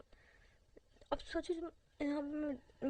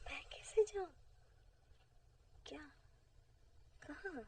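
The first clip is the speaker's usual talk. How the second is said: whisper; 2 semitones higher, 7 dB quieter than usual. Acted.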